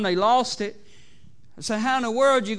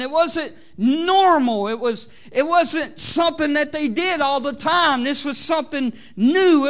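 loud, raised preaching voice; fervent preaching; 7 semitones higher, 6 dB louder than usual. Acted. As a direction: drive each phrase home to the congregation. Preaches at full force.

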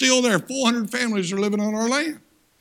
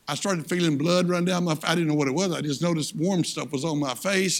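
ordinary delivery

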